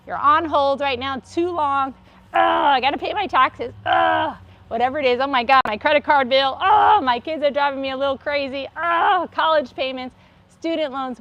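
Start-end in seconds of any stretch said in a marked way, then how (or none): 2.3-11.2 louder, higher exasperated groans; frustrated venting. Shown as a demonstration. Teacher venting bills and kids stress, exasperated. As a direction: vent everyday frustrations with a groan. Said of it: "let it out right now".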